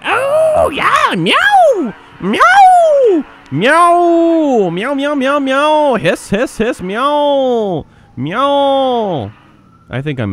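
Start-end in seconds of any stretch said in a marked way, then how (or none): 0.0-5.4 very high, loud drawn-out meows; yowling cat. Pretend. Player being the cat character, wild yowling. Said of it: "I'm just playing the character here". 5.4-9.3 high-pitched cat noises as words; cat meowing and hissing. Pretend. Player being the cat character, high meowing. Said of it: "I'm just playing the character here".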